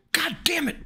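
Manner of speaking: high-pitched real frustration; exasperated outburst. Real, not acted. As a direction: curse in sudden high exasperation. Exasperated at losing his place.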